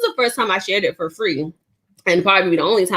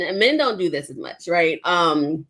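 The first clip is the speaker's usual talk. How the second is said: usual speaking voice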